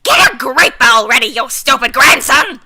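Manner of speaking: put-on loud, scolding old woman; furious yelling granny. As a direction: bellow at the grandson. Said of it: "A thunder shakes my eardrums".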